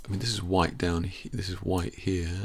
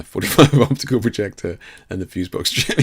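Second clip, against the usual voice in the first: laughing through the words into a burst of laughter; 3 semitones higher, 10 dB louder than usual. Real, not acted. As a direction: chuckle and crack up. Laughing at his own joke.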